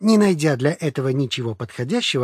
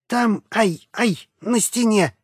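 pained yelps; 7 semitones higher, slower than usual. Acted. Flo yelping from ear twist.

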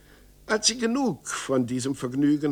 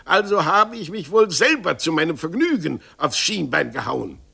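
furious; 5 semitones higher, 6 dB louder than usual. Acted. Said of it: "außer sich, und er rief".

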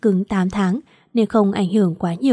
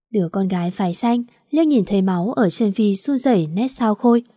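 plain reading voice

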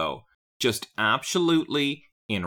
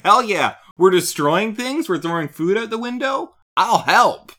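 high, loud gung-ho enthusiasm; hammed-up eager sidekick, all in; Fred; 8 semitones higher, 6 dB louder than usual. Co-host imitating Fred joining his hero without question, excited. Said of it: "Fred's just like".

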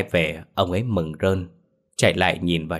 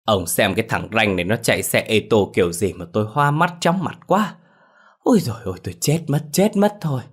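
whining complaint; 3 semitones higher than usual. A narrator's reading. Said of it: "miệng vẫn không ngừng chửi".

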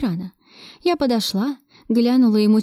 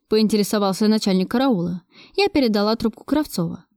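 ordinary delivery, nothing marked

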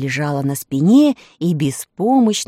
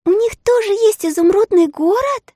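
put-on curious little girl; 4 dB louder than usual. A narrator's reading. Elli asks.

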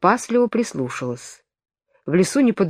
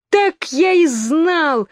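high, slow, louder wail; wailing yell; 10 semitones higher, 3 dB louder, much slower than usual. A narrator's reading. Twin stamping his feet, wailing in despair. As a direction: howl it in despair. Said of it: "завопил двойнюшечка".

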